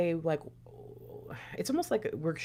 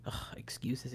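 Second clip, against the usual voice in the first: dismissive scoff; 8 semitones lower, 13 dB quieter, slower than usual. Retold or imitated. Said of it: "just thinking".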